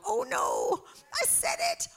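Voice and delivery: high squeaky "oh no" dismay; mock dismay. Believer catching their own words, in mock high dismay. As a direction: play the caught-out believer. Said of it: "you're gonna go".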